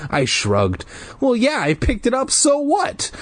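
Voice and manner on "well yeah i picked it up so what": put-on high kid voice, defiant; squeaky boy shrugging it off; higher boy voice saying so what; defensive kid.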